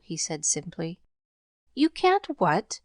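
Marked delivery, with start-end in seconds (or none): 1.7-2.8 high, slow disbelief; can't believe her ears; a lady stunned by a refusal; incredulous cry. A narrator's reading. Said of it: "cried Missus Carew".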